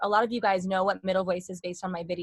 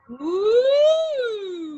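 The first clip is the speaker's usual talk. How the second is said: long high, louder "woo" siren; high sliding woo; 12 semitones higher, 7 dB louder than usual. Shown as a demonstration. Teacher leading a vocal exercise, high sustained woo. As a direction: swoop up high and hold it. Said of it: "everyone go like this".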